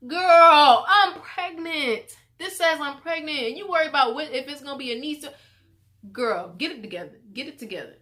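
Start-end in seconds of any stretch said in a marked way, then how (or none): 0.1-5.3 loud high exasperation; exasperated yell. Retold or imitated. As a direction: snap at her sister to focus. Scolding sister, exasperated.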